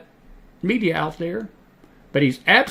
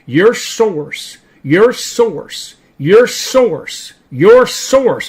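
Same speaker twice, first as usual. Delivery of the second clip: "source" hit hard each time, building louder; emphatic repetition; 4 semitones higher, 6 dB louder, much slower than usual. Real, not acted. Preacher to listeners on where to look, "your source" stressed harder each time. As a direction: drive home "your source" with mounting force and a gap after each. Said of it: "I cannot stress this enough".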